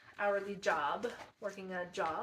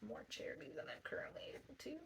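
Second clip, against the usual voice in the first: whisper; 5 semitones higher, 9 dB quieter, faster than usual. Real, not acted. Trailing self-aside about charity.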